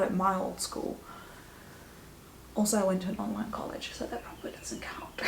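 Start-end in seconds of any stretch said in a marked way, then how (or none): 2.6-5.2 whispered self-aware aside. Real, not acted. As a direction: drop to a sheepish whisper. Joking aside about online college.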